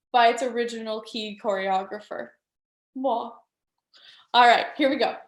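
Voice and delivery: ordinary talk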